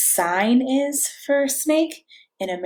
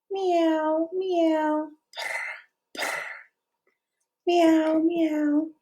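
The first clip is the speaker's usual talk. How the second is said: higher, soft kitty meows; gentle meowing for a toddler; cat meow; 6 semitones higher, 4 dB quieter, much slower than usual. Shown as a demonstration. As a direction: mew like a little cat. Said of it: "Can you make the sound of a cat".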